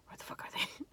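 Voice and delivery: soft unvoiced whisper, eyes shut; whispered giggle. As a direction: whisper with a stifled laugh. Whisper while pretending to sleep.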